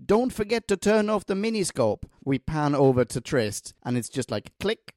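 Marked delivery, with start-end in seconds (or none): 0.0-2.0 high-pitched retold call, no extra volume; mock shout. Doctor leaving the room reminds Trist, mild mock shout. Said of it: "he shouts at him".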